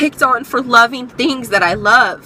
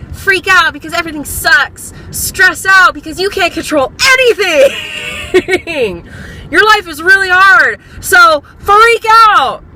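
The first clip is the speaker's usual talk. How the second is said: hammed-up, high-pitched panicky nagging; anxiety voice; 7 semitones higher, 4 dB louder than usual. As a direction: egg them on hysterically, stretching the last words high. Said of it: "she's a little voice in your head".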